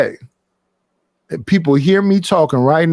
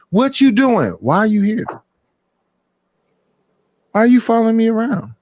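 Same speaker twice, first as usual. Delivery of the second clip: higher-pitched mock interrogation; raised, put-on demanding questions; nagging questions; 5 semitones higher than usual. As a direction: imitate someone pestering with questions. Mimics partner questioning him on his outing.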